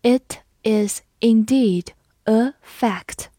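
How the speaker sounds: each word slow and clear; slow reading. Shown as a demonstration. Teacher reading slowly for students, slow and clear. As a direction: read it slowly for learners. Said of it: "好，我们慢读一遍。".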